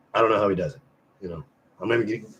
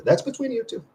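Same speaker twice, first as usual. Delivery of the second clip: high teasing; 13 semitones higher than usual. Pretend. Teasing reply to chat comment.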